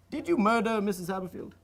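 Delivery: normal talking voice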